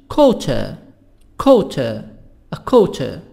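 QUARTER with crisp T and no R, 'kwaw-tuh'; British pronunciation. Shown as a demonstration.

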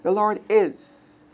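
drawn-out "The Lord is", left unfinished; slow meditative. Shown as a demonstration. Teacher modelling meditation on the verse, slowly. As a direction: dwell on it and wait. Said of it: "And the list goes on".

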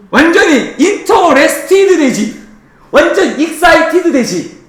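high, hammed-up thrill; mock excitement. Teacher teasing students about memorising it all, mock excitement.

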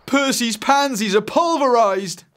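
high, loud cry of dismay; horrified outcry. Acted. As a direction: wail it out in acted horror. Boy discovers the wrecked flowers.

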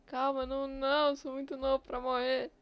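loud, high panicked pleading. Real, not acted. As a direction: beg for life in a panic. Suffocating, oxygen running out. (pânico)

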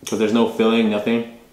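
chewing between words; talking around food; mouth partly full. Real, not acted. Seen 1: holds bread at mouth, lips pursed chewing between phrases.

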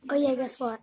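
ordinary delivery

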